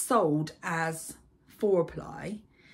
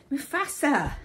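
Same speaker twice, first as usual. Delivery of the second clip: calling out; 9 semitones higher, 6 dB louder than usual. Real, not acted. Calling the cat across the house.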